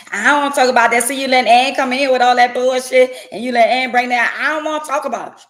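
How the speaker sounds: loud irritated partner shutting down talk; defensive snapping. Acted. Partner on the couch, annoyed at the question, loud defensive snap. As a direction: snap back, refusing to discuss it.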